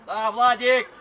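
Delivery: very loud call to a player; encouraging shout. Real, not acted. Coach cheering Vladic, loud shout. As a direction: call out approval across the pitch.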